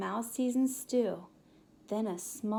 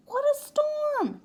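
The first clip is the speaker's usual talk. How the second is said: much higher, louder bird voice for the wren; excited high wren twitter; 7 dB louder than usual. Acted. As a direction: chirp it out like a startled little bird. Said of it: "twitters wren".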